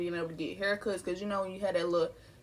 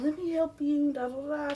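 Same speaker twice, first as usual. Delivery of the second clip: mom stepping in; 6 semitones higher than usual. Retold or imitated. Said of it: "she was just like".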